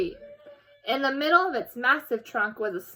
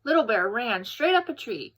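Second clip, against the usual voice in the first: plain reading voice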